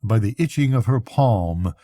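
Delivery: usual speaking voice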